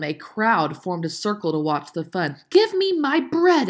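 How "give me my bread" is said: loud, high, slow demand; shouting. A narrator's reading. Ellen demands her bread back, loud shout. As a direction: shout it word by word. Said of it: "Ellen shouted".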